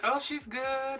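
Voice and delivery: high-pitched, singsong reply; calling back. Retold or imitated. Answering the neighbours about mom, high and singsong. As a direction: sing out a polite answer.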